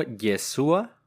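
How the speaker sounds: Yeshua sin sonido sh, como «Ye-SÚ-a»; pronunciación correcta. Shown as a demonstration. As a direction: say Yeshua with a plain s and stress on the U. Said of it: "sin H y con acento en la U". (Yeshua con ese y acento en la u)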